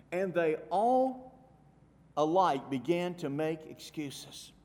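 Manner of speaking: loud and slow, every word weighed; preacher reading scripture slowly and heavily; slow emphasis. Acted. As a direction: drag it out for weight.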